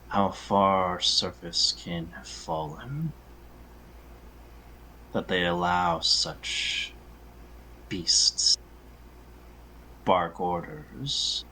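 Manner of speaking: slow, haughty elf, with long pauses; disdainful, drawn out with cold restraint; cold imperiousness. Acted. Elf looking down on the human, cold and imperious. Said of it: "this sort of cold, sort of imperiousness".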